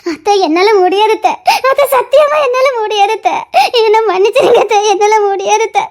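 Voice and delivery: tearful, breaking voice; crying apology; sobbing refusal. Acted. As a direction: plead through sobs. Refuses through tears.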